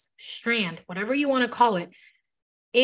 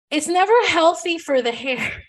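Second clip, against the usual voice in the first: high, loud, breaking into a laugh; 6 semitones higher, 5 dB louder than usual. Real, not acted. Stylist admitting bleach harms hair, laughing. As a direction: let a laugh spill out at the end. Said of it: "And I'm laughing".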